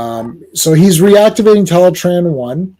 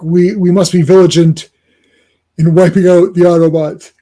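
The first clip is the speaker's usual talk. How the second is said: loud, measured proclamation; robot's declaration; 6 dB louder than usual. Acted. Fan voicing a robot's battle vow, loud declaiming. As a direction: declaim it like a solemn commander.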